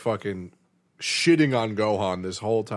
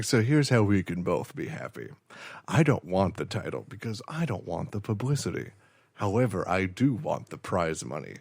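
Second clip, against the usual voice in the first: lower, softer conspiratorial voice; low scheming deal; 6 semitones lower, 6 dB quieter than usual. Retold or imitated. Fighter proposing a rigged match to Hercule, low and hushed. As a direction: lay out the deal quietly.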